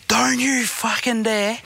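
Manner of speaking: high, slow, angry snarl at the driver; retold angry roar. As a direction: replay the moment you roared at him. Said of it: "I fucking roared up beside him and goes".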